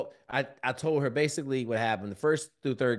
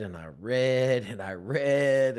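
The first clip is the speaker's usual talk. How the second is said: and I read stretched slow and heavy, a touch louder; slow, dragged-out reading; 5 dB louder, much slower than usual. Shown as a demonstration. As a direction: drag each word out like struggling to read. Showing how slowly he read.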